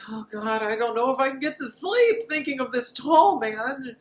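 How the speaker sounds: high mock-anxious whine; fretful Michael. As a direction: worry aloud in a squeaky put-on voice. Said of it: "and he's like".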